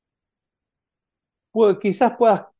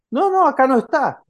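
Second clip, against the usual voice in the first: high, louder no from a relative; a high, louder relative's reply; relative answering; 10 semitones higher, 4 dB louder than usual. Retold or imitated. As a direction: pop up high and loud to say he isn't here. Relatives answer no, not here.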